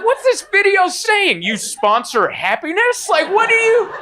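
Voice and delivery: mock outrage at the ad; shouted disbelief with a laugh; indignant disbelief. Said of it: "I'm like".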